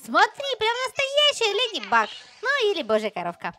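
ordinary talk, nothing marked

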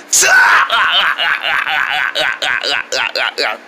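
long, stuttering cackle; drawn-out teasing guffaw; mocking laugh. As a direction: laugh hard at someone's funny voice. Mocks the wheezy voice.

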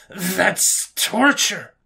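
very slow, high, drawn-out outrage; appalled protest. Acted. Alien prisoner protesting the threat of cooked meals, appalled. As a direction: stretch each word in horror.

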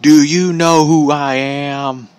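drawn-out obnoxious whine, slightly high; obnoxious wrestler impression, slow and held back; Bully Ray. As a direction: drag each word out mockingly at normal volume. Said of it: "he says that so obnoxiously".

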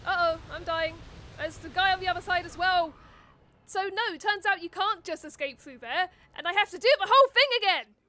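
loud, rising exasperation; frustrated shouting. Real, not acted. Dies, must redo whole level.

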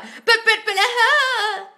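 very high, loud, drawn-out mock blubber; mock wailing protest. Coach imitates a needy viewer arguing with an ex, wailing. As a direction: splutter and wail in hammed-up protest.

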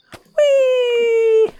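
very high, loud gleeful whoop; gleeful whee. Acted. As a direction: whoop like a kid on a slide. Gleeful cry tossing the officer.

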